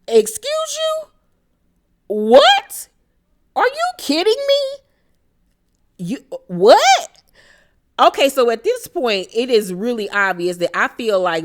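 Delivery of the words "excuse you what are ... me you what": much higher, louder, incredulous squeals; outraged disbelief. Real, not acted. Host reacting to Lenny choosing tennis, shocked outraged squeal. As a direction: shriek in shocked disbelief at each question.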